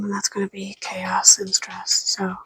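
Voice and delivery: hushed whispered aside. Real, not acted. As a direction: drop to a whisper. Aside while pets nearby.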